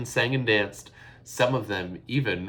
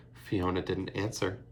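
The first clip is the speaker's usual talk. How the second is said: usual speaking voice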